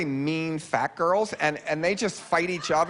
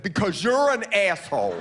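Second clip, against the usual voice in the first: higher, emphatic mock snap; exasperated dad; 5 semitones higher than usual. Dad answering his kid on the street, mock exasperated retort. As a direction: fire back as a fed-up father, hammed up. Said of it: "And Dad said".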